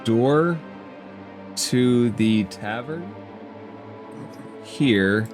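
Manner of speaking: ordinary talk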